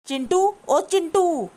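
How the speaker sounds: high, slow drawn-out call to her son; mother calls her son to take her side, calling out. Acted. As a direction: stretch the name to reach another room.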